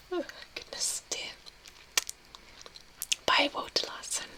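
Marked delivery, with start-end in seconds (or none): none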